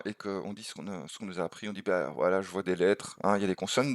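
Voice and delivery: ordinary delivery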